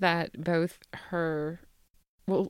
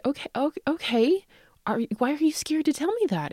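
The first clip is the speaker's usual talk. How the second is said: surprised mom; 10 semitones higher than usual. Retold or imitated. Mother retells her reaction.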